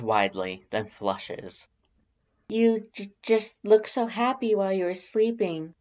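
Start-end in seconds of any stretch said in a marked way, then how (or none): 2.5-5.7 high, timid stammering voice; nervous stammer. Acted. Shy Alphys flustered about watching you sleep, high stammer. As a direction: stammer it out shyly, voice pitched well up. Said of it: "she stammers".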